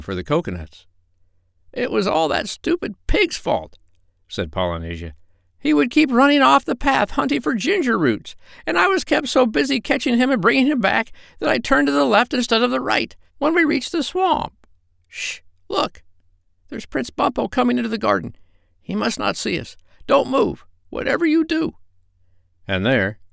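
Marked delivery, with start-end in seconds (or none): 1.7-14.5 very high squawky parrot. Acted. Parrot character voice. 15.1-16.0 sharp high whisper. Acted. Parrot hushes friend. 16.7-21.8 high urgent parrot. Acted. Parrot character voice.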